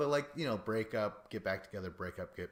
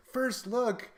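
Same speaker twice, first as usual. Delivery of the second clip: a much higher, louder First Look in disbelief; incredulous; 14 semitones higher, 8 dB louder than usual. Retold or imitated. Recalling his reaction to the host's new show, high incredulous squeal. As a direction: squeal the title as if it's a joke. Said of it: "But I was like".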